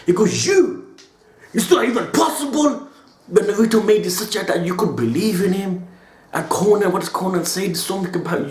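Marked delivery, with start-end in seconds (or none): 0.5-2.9 mock shout. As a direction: bark it out like an enraged enemy. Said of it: "Nagato starts shouting at him".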